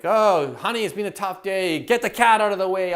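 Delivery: a tired, mock irritated sigh at home; irritable at home. Coach imitates grumbling at his wife, fed up.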